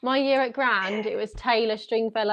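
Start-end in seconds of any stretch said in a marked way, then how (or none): none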